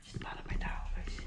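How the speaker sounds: frightened whisper; whispering while hiding. Real, not acted. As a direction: hide and breathe the words. Thinks someone is there.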